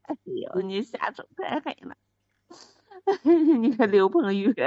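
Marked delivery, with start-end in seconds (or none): 3.1-3.6 short loud laugh breaking character; burst of laughter. Real, not acted. Wife actor breaking up laughing during the staged fight. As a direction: crack up mid-scene.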